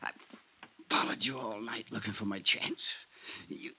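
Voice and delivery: high strained voice of a wounded man; dying, in pain. Acted. Dying Frank tells Johnny he was shot, strained. Dying man's last words.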